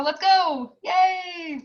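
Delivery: rally the class with a bright yay; excited cheer. Real, not acted. Cheerful kickoff of the prep course.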